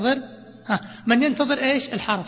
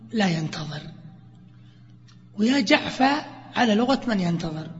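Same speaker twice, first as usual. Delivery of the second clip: ordinary delivery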